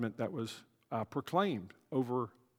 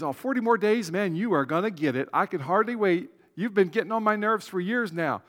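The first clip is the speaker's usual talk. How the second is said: mock gleeful, eager to punish; lightly voiced gloating judge; gloating impatience; 6 semitones higher, 4 dB louder than usual. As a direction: rub your hands, can't wait to get them. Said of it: "he can just counting the days".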